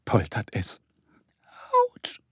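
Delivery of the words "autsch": faint little moan of pain; whimpering. A narrator's reading. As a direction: whimper it very softly, hurt. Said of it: "jammert da jemand". (leise jammernd)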